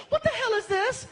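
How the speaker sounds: high, incredulous mock girlfriend voice; girlfriend's demand. Girlfriend catching him with cakes, high incredulous demand. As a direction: play the stunned girlfriend at three a.m.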